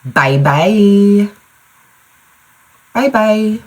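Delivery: high-pitched little-kid imitation; imitating toddler. Mom mimics her daughter's goodbye, high toddler voice. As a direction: mimic a small child, pitched well up.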